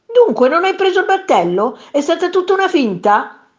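high, loud questioning cry; indignant shout. A narrator's reading. Man to his nose sailing away, indignant shout. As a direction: demand it at a high-pitched yell. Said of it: "gridò quel signore".

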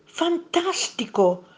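high, slow, drawn-out wonder; muttered amazement. A narrator's reading. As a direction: marvel slowly to yourself. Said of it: "borbottò il secondo mostro". (stupore, voce acuta e lenta)

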